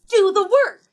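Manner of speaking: loud, high imitated command; movie-line shout. Quoting the heroine's line from the movie, loud high command. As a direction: belt it out as an emphatic order. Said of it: "like she says in the movie".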